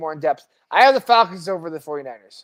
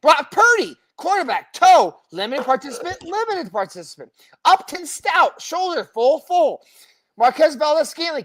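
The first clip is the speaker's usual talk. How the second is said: injury roll call; 12 semitones higher, 3 dB louder, slower than usual. Acted. Host racing to finish a long injury list for co-host, high emphatic roll call. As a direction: drive through the names, pitched up and emphatic.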